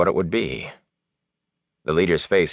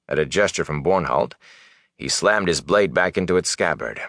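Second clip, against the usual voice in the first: ordinary delivery, nothing marked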